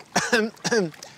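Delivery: coughing up a swallowed bug; choking cough. Real, not acted. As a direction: hack at something stuck in the throat. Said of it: "j'ai avalé un moustique".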